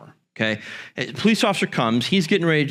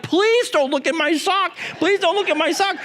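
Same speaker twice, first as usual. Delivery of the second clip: much higher pleading through a laugh; desperate kid plea. Retold or imitated. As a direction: beg in a panicked little voice. Kid fearing sock is searched.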